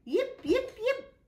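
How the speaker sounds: high excited puppy yapping; yappy dog. Acted. Zippity the stray bounds about, yapping high. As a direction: yip like a bouncy little dog. Stray zips round room.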